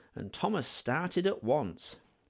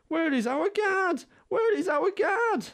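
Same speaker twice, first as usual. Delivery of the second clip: much higher, louder mock wail; coaches crying out; 5 dB louder than usual. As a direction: cry out like frightened little coaches. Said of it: "they cried".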